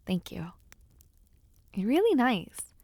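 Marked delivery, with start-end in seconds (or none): 0.1-0.5 soft whisper. Acted. Shy thanks to escort.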